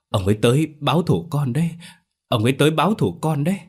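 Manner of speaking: high, rising frightened insistence; hoảng sợ. Acted. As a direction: warn mother in mounting panic. Con trai sợ hồn người chết báo thù. (hoảng hốt, giọng cao dần)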